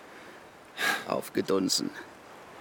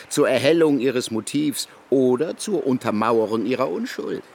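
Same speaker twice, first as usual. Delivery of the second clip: normal talking voice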